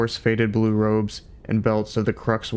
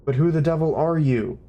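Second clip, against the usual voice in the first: high, loud outraged cry; 6 semitones higher, 3 dB louder than usual. A narrator's reading. Fook outraged at the intruders, exclaiming. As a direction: demand it in outrage. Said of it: "exclaimed an outraged Fook".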